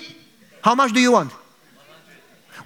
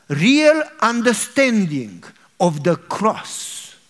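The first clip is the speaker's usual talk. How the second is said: solemn slow preaching; slower than usual. Real, not acted. Preacher slows for the point.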